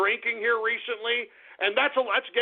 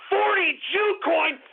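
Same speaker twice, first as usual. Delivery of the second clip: outraged; 7 semitones higher, slower than usual. Acted. Same name, shouted in outrage.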